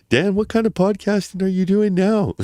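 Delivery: high voice of a mother calling; imitating mum. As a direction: mimic mum asking from the next room. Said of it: "Oh, she".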